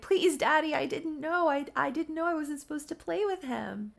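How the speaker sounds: put-on high, frightened little boy begging; pleading child. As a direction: beg Daddy not to punish you. Child begs his angry father.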